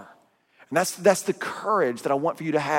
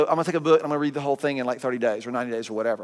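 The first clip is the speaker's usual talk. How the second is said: rushed; 4 semitones lower, much faster than usual. Retold or imitated. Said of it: "we go".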